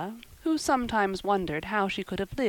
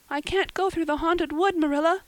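high, raised, desperate cry; 11 semitones higher, 6 dB louder than usual. A narrator's reading. Anne refusing to enter the haunted wood, desperate. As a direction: plead in a high girl's voice. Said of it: "cried Anne desperately".

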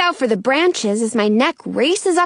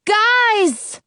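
high-pitched call down from up high; calling from above; 9 semitones higher than usual. A narrator's reading. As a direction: call out to friends far below. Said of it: "Penny's voice comes from way above us".

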